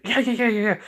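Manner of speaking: high, rapid-fire yeahs; eager agreement. Acted. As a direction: play a mother hurriedly agreeing. Mother eagerly agrees in retold dialogue.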